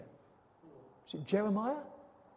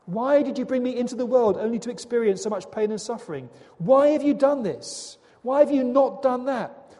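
louder, higher voice hurling questions at God, slowing on the first two; anguished loud questioning; 5 semitones higher, 7 dB louder than usual. Acted. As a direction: cry out why like a prophet in pain.

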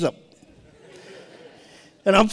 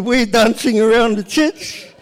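ordinary talk, nothing marked

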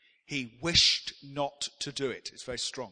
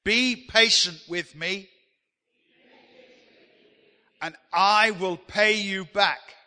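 loud, slow lead line for the congregation; call and response; 4 semitones higher, 9 dB louder, slower than usual. Shown as a demonstration. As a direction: boom it out so the room joins in. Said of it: "I want you to say that with me".

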